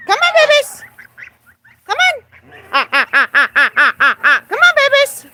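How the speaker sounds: sing-song coaxing to a bird; high coaxing pet voice. Real, not acted. Owner coaxing her parrot to come see babies, high sing-song. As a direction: coo high and bright to coax the bird out.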